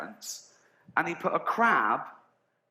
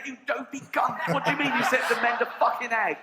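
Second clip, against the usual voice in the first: mock rant; 8 semitones higher than usual. King berates the messenger.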